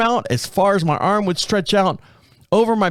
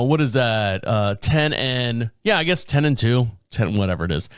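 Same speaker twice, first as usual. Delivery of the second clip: ordinary delivery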